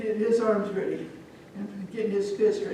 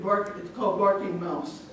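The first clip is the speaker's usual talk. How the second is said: plain reading voice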